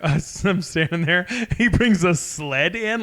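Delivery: ordinary talk, nothing marked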